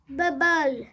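loud alarmed shout of the name; cry out. Acted. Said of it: "Squeak cried".

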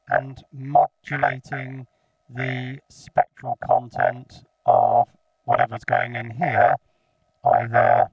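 normal talking voice

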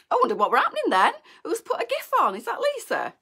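sudden high alarmed questions, fast at first; jolted by something popping up on screen; startled. Real, not acted. Host startled mid live stream by an unexpected gif, surprised.

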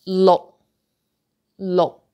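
"lo" cut short on an unreleased K; stopped K. Shown as a demonstration. Teacher models Cantonese number six, "lo" with stopped ending. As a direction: stop "lo" abruptly, tongue closing without a puff. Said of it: "no K sound".

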